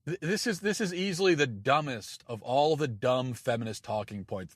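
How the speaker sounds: usual speaking voice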